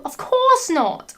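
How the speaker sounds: high, drawn-out mock gasp of denial; mildly shocked, stretching the words; gasped protest. Narrator voicing the heroine reassuring her lover, mock gasp. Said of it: "I gasped".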